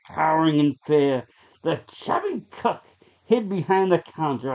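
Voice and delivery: plain reading voice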